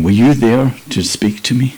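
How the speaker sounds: ordinary delivery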